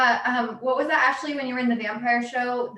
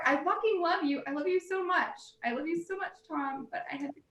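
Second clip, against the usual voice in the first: higher and softer, fading apology to Tom; tender apology; 5 semitones higher, 6 dB quieter than usual. Real, not acted. Telling Tom Hanks she loves him after killing him, softly. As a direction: coo an apology to a beloved star.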